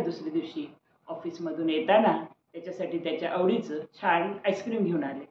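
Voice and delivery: usual speaking voice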